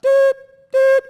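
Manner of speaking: two loud, high vocal beeps; imitated machine beeps. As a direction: mimic the machine cutting in. Imitating answering machine beep.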